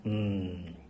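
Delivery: ordinary talk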